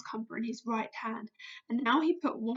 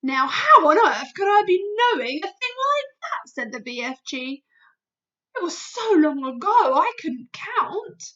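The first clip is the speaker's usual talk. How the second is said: higher, louder giant declaring; BFG, insistent; 6 semitones higher, 9 dB louder than usual. Acted. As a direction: proclaim it as a giant. BFG voice in story.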